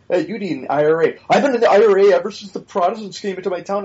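quoting the skit in a hammed-up Irish brogue, quick; lightly done Irish accent, parodying bad lines; mock Irish brogue. Mocking the SNL Conor McGregor in a loose Irish brogue. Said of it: "a horrible Irish brogue".

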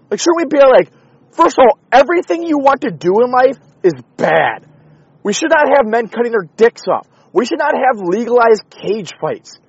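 loud, high, furious ranting; angry rant. Real, not acted. Fed-up podcaster ranting to his listeners, shouting angrily.

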